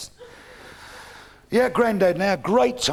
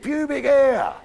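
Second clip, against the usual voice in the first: louder, high-pitched schoolboy repeat; emphatic repeat; 8 semitones higher, 6 dB louder, slower than usual. Acted. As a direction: say it louder for the disbelieving teacher. Repeats it after being questioned.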